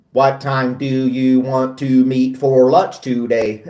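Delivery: slow, evenly spaced robot delivery; drawn-out syllables for a speech-to-text machine; robot talk. Retold or imitated. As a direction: enunciate word by word like a robot. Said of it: "talk like a robot".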